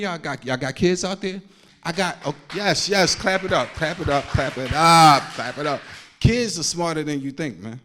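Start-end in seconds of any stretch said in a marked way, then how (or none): none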